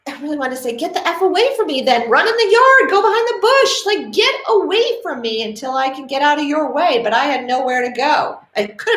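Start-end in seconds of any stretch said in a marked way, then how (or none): 0.7-7.0 imagined angry retort. Pretend. What she wanted to say back.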